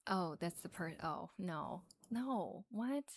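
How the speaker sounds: ordinary talk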